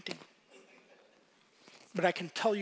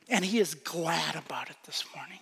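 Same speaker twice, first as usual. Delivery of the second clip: hushed whisper; 3 semitones lower than usual. Real, not acted. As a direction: whisper it like a secret joy. Sermon point dropped to hush.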